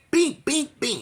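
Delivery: high bink punches; punch noises. Retold or imitated. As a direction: tap out the punches. Wife's punches imitated.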